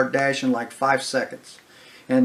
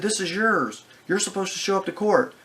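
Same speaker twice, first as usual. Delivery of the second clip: mildly panicked; 7 semitones higher than usual. Retold or imitated. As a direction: mimic a flustered clerk insisting. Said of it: "she's there panicking".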